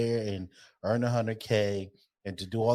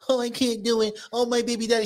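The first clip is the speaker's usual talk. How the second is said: high-pitched imitation of a whiner; mock complaining; 12 semitones higher than usual. Mimicking people who moan about single motherhood, high mock complaint. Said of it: "when I hear people complain".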